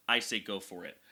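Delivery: ordinary talk, nothing marked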